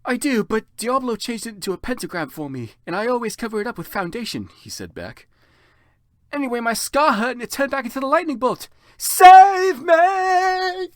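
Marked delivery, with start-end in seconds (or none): 0.0-4.5 high, light reply; higher brisk answer; Vampire voice. Acted. Vampire replies. 6.3-10.9 very high, loud cry for help; very high, loud panic; panicked plea. Acted. Vampire pleads.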